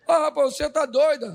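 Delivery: high, loud mock retort; exclaiming Job. Job to his wife, loud mock disbelief. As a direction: scoff at her as crazy. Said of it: "Ele falou".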